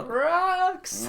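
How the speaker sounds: sudden squeaky high shout of Rocks; exclaiming rocks. Pretend. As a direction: blurt it out like a big reveal. Mock outburst at fossil joke.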